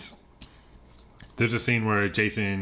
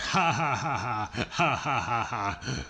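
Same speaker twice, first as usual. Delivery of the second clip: badly faked, evenly repeated ha-ha laugh; hammed-up movie-character guffaw; terrible fake laugh; 2 semitones higher than usual. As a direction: imitate a bad actor forcing laughter. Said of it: "some really terrible fake laughing".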